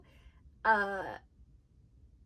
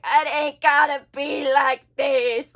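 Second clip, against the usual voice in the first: loud, slow dopey imitation; stupid-sounding hillbilly; 2 semitones higher, 11 dB louder, slower than usual. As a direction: drag it out like a dumb hillbilly. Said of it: "like, stupid and be like".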